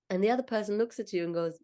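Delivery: normal talking voice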